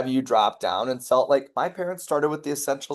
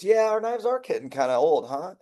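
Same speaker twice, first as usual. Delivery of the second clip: "Yeah, our knives" lifted high and casual; husband voice; 7 semitones higher than usual. Retold or imitated. Husband agreeing with his wife, voiced high. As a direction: play the husband lightly, pitched way up.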